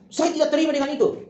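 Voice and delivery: high-pitched blunt refusal; impolite objection. Acted. Student wrongly rejecting someone's opinion, impolite objection. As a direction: object rudely, pitched well up. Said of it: "Ah, itu salah, tidak seperti itu".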